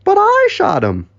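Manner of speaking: very high-pitched protesting voice; indignant squeak. Acted. Cyril contradicting Humphrey's confession, indignant squeak. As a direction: squeal the objection.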